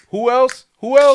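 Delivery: high, loud repeated demand; hype shout. Real, not acted. Hyping more names.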